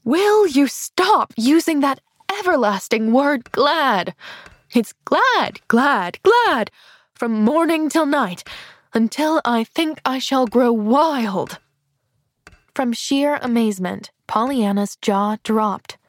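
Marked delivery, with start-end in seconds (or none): 0.0-11.6 exasperated aunt, higher with punched words; nerves snapped. A narrator's reading. Aunt at her wits' end scolds Pollyanna, exasperated. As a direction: hammer the repeated GLAD, then draw out the end. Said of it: "Miss Polly's nerves had snapped at last".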